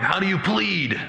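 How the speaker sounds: high, ringing courtroom question; judge demanding a plea. Acted. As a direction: put the formal question to the accused. Judge puts the charge.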